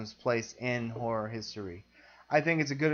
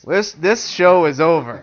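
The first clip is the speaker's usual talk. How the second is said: loud, slow mock outrage; 4 semitones higher, 14 dB louder than usual. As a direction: declare it loudly and slowly.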